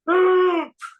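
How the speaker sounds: loud, high shocked gasp; startled intake of breath; sharp gasp. Retold or imitated. Sudden shock while retelling a meeting.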